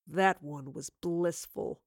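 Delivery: slow low purring villain; seductive demon purr. A narrator's reading. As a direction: purr it with cruel pleasure. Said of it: "He purred at the memory".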